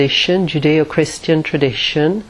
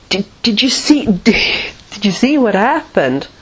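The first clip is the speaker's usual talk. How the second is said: excited question; 6 semitones higher than usual. Retold or imitated. Film crew excitedly asking film star.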